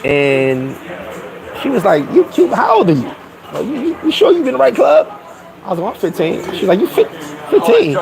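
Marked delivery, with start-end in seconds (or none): 2.1-5.1 high, stammering incredulous questioning; retold baffled woman, pitched up; imitated woman's disbelief. Woman doubts his age. 6.8-7.9 high, broken-off incredulous repeat; retold stunned woman, voice jumping up; imitated shock. Woman shocked at his age.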